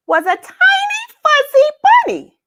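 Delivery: very high, tiny and sweet; tiny squeaky voice. A narrator's reading. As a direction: shrink your voice to bunny size. Reveals tiny bunny, squeaky voice.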